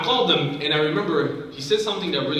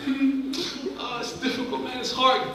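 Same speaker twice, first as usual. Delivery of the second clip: tearful lament; 7 semitones higher, 4 dB quieter, slower than usual. Retold or imitated.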